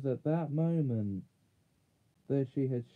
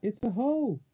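high, raised call from above; 9 semitones higher, 4 dB louder, faster than usual. A narrator's reading. Jill calls down to the others from the hole, calling out. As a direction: call it down to them, pitched high. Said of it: "called Jill's voice".

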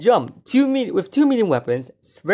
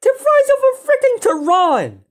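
very high excited cry; astonished outburst; 13 semitones higher, 3 dB louder than usual. Real, not acted. Gamer hammers home the price, high astonished outburst. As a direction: repeat it at peak amazement. Repeats price comparison, more worked up.

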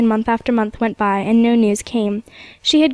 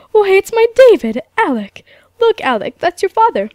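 much higher and louder, bursting with joy; overjoyed recognition; 9 semitones higher, 6 dB louder than usual. A narrator's reading. Grandfather recognising his lost son, overjoyed and loud. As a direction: cry it out as the lost son is found.

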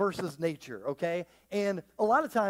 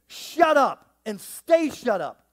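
louder, commanding shut up; firm command; 4 semitones higher, 8 dB louder, slower than usual. Acted. Jesus silences the storm, firm loud command.

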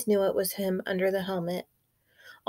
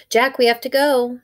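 put-on urgent babysitter; 4 semitones higher, 7 dB louder than usual. Babysitter hurries the boys away.

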